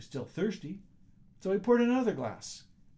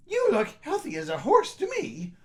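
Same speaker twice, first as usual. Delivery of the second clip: scornful wife; 10 semitones higher, 6 dB louder than usual. Acted. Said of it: "said Missus Schlemiel".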